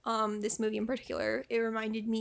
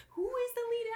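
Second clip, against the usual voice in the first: high, laughing disbelief; 12 semitones higher than usual. Real, not acted. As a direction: squeak it out mid-laugh.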